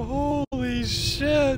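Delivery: slow, drawn-out holy shit; stunned shout. Real, not acted. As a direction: exclaim in disbelief. After the scare.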